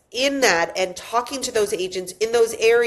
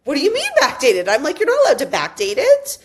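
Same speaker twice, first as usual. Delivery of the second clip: higher and faster, retold outrage; indignant disbelief; 6 semitones higher, 4 dB louder, faster than usual. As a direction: push back in mild mock outrage. Retold indignant reply to manager.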